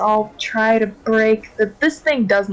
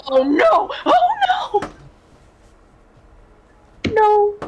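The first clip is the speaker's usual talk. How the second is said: dismayed shout; much slower than usual. Real, not acted. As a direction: wail it out in real alarm. Character hit by acid pseudopod.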